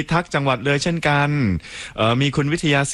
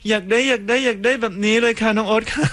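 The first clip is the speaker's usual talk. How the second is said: voicing a listener; 8 semitones higher than usual. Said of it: "คุณปูปู บอกว่า".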